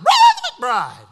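very high, loud preacher's voicemail; phone message impression. Preacher mimics a friend's voicemail, loud exaggerated impression. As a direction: belt it out high and drawn out. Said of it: "That's just the way it sounds".

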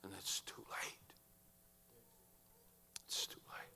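hushed, sombre whisper; barely audible, letting it sink in; grave whisper. Real, not acted. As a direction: drop to a whisper after the climax. Grave hush after shouted climax.

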